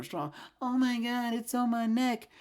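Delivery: high hammed-up whine; mock the helpless victim; mock struggling victim. Mocking the character wrestling the doll, high whine.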